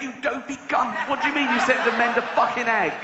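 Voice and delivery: high, incredulous telling-off; exasperated rant. Acted. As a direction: tear into the fool for his stupidity. Officer berates the king.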